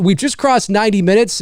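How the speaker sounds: ordinary talk, nothing marked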